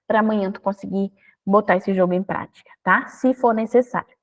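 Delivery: usual speaking voice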